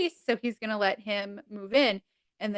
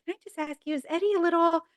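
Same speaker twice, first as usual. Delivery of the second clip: high, hushed, confiding question; mock confiding Chandler; 8 semitones higher, faster than usual.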